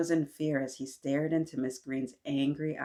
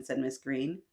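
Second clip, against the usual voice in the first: usual speaking voice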